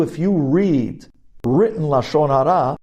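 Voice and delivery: normal talking voice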